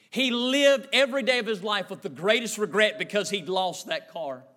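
normal talking voice

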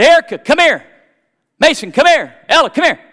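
loud, high call across the yard; calling the kids. Retold or imitated.

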